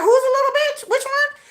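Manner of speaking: exaggerated high-voiced mock retort; high mock challenge. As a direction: pitch it way up like a sassy challenge.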